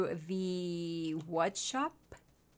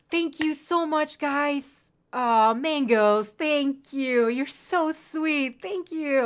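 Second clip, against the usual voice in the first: drawn-out, higher, sweetly grateful; touched gratitude; 4 semitones higher, much slower than usual. Real, not acted. Streamer thanking a sweet subscriber, touched. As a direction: gush thanks, stretching the words.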